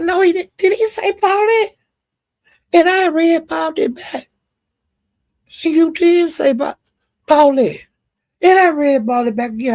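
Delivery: high, loud disbelief; can't-believe-it high-pitched outrage; shocked disbelief. Real, not acted. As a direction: squeal it, stunned he said her name. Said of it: "That hit me out of nowhere".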